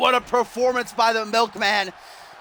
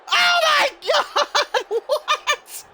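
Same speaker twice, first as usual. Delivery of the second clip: very high, drawn-out shriek of astonishment; shrieking commentator, breaking into a laugh; stunned disbelief; much slower than usual. Real, not acted. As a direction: scream it slowly in total disbelief.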